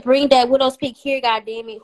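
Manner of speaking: loud, insistent read-back of a comment. Retold or imitated. Repeats it louder after being asked.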